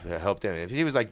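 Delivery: ordinary delivery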